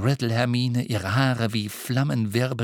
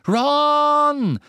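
long, high shout of the name; desperate full-voiced cry; calling out; 7 dB louder than usual. A narrator's reading. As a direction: yell to snap him out of it. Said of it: "rief er".

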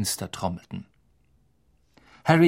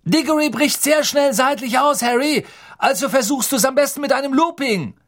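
much higher, louder shouted call; calling after; 14 semitones higher, 5 dB louder than usual. A narrator's reading. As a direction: yell after him down the hall. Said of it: "Wood rief ihm nach".

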